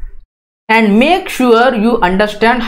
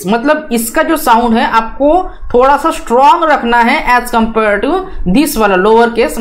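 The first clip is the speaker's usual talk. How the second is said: ordinary talk, nothing marked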